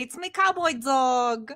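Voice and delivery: put-on British introduction; fake British accent. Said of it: "I'm not really British".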